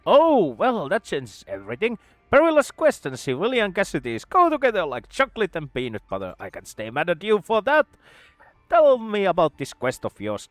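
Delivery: high loud sudden enthusiasm; eager mad scientist. Acted. Scientist suddenly curious about the quest, high loud eagerness. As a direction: switch from grief to gleeful interest.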